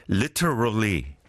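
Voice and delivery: "Literally" with a crisp British t; British style t. Shown as a demonstration. Host tries the British t for listeners. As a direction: say "Literally" with a hard British t as in tea. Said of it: "pronounce T as in tea, like the British style".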